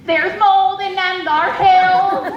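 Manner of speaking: loud, slow folksy aunt; imitating her auntie's homespun warning. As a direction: drawl it like a warning old aunt. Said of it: "I hear my dear auntie's voice".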